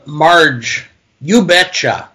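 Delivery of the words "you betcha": a touch of Minnesota "You betcha."; Minnesota accent. Acted. Dad quotes Marge's line in a mild Minnesota accent. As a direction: say "You betcha." with a light Upper Midwest twang. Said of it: "let's call them the accent lines".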